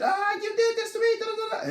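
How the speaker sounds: high squealing hammed-up wail; mock upset woman. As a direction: squeal it like a hysterical girlfriend. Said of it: "she's all upset".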